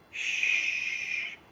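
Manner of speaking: soft unvoiced shh; shushing. Shown as a demonstration. Teacher shushing the class to show quiet, soft shh. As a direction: hush the room with a breathy shh.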